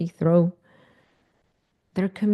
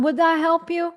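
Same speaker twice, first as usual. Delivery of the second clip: "Would that help you?" asked high and doubting; mock the idea it helps; much higher, doubting ask; incredulous question; 9 semitones higher than usual. Real, not acted. Mocking unhelpful answer.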